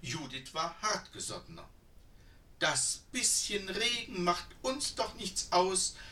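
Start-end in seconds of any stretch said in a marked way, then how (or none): 2.6-6.0 fluting. A narrator's reading. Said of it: "flötete sie".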